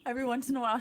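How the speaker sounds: ordinary delivery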